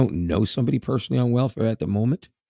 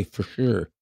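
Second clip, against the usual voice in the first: put-on Canadian accent. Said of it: "there was totally a Canadian accent in it".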